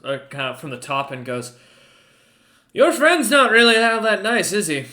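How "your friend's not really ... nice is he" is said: high, loud voice of a large figure; big creature voice. Acted. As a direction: boom it down from above, mocking. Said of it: "looks over and goes".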